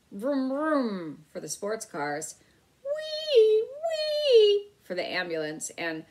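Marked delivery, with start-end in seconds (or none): 0.1-1.2 engine noise made with the mouth; vroom car noise. A narrator's reading. Boy playing with toy cars, mouth vroom noise. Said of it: "making noises with his mouth". 2.8-4.7 very high loud wailing siren; siren noise. A narrator's reading. Boy playing with toy ambulance, high siren wail. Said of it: "making noises with his mouth".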